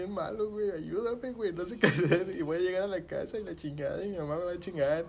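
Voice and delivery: high-pitched, hammed-up panic; imitated scared pleading. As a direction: replay begging your friend for help. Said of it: "bien espantado". (súplica asustada y aguda, imitada)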